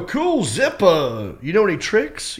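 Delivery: high, drawn-out showing off; cocky showmanship. Retold or imitated. Comic shows off with a thrown Zippo, playfully cocky. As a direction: relish it like a stage trick.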